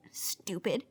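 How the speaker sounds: very soft, whispered grumble; muttered scorn. Acted. Grumbling about the reset's name, scornful whisper. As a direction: mutter it under your breath.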